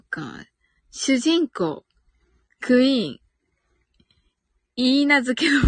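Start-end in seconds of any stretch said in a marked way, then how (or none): none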